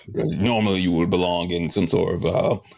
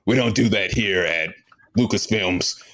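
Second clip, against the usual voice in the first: usual speaking voice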